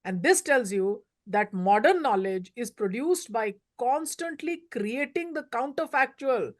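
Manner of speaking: ordinary talk, nothing marked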